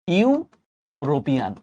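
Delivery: ইউরোপিয়ান drawn out slowly, starting with a "yu" sound; slow demo. Shown as a demonstration. Teacher slowly shows students that European starts with a "yu" sound. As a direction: stretch out ইউরোপিয়ান slowly, "yu-ro-pian". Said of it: "উচ্চারণটা কিন্তু এরকম".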